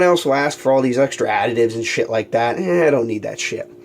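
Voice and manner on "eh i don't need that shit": ordinary talk, nothing marked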